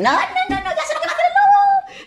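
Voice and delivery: very high piglet voices; squeaky know-it-all piglets; clever piglets. Acted. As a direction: piglets outsmarting the wolf.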